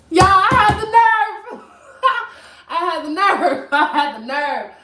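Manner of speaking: high-pitched exclamation breaking into laughter; amused disbelief. Real, not acted. Storyteller laughing to viewers at her own nerve, amused disbelief. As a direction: cry it out high, then laugh through it.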